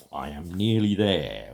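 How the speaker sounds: ordinary delivery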